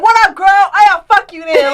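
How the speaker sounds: high, loud imitated catcall; mock holler out of a car window, turning dismissive; yelling from a car. Man calling to a woman from his car, imitated yelling. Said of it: "like, yelling out the car".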